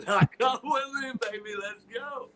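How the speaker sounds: high, laughing mock taunt; teasing taunt. Replaying the taunt at his old partner, laughing tease. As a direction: tease him like a cocky heel.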